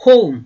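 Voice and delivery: "home" said quick and loud with an ou glide; crisp English "home". Shown as a demonstration. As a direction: snap out "home" fast. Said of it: "그걸 빨리 하셔야 돼요".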